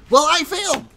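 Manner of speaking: high, loud despairing yell; loud dismay. Real, not acted. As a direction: cry out as the shot fails. Shot goes wrong.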